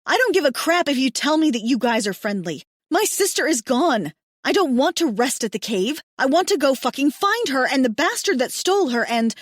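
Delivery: loud, rising angry rant; furious shouting. Acted. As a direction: yell her demands at them. Stranger shouts at the men.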